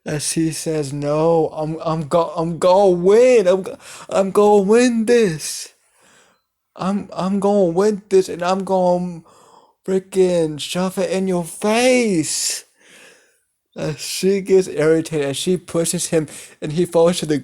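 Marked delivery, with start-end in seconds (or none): 0.9-12.6 higher, louder stubborn insistence; drunk boasting. Acted. Drunk brother refusing to give up the drinking game, in a high loud boast. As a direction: refuse to lose, pushing the words up high.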